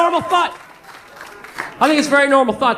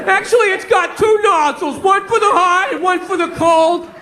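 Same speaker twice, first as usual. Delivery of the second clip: higher-pitched mimicry of an enthusiastic neighbor; excited neighbor; 6 semitones higher than usual. Neighbor showing off his garden hose, excited. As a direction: gush proudly, mocking a gadget lover. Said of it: "He's all excited about some garden hose".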